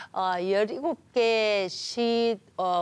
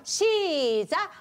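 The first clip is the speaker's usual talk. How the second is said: drawn-out start call; 8 semitones higher, 3 dB louder, much slower than usual. Shown as a demonstration. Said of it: "한번 해볼게요".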